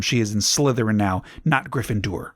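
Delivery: ordinary delivery, nothing marked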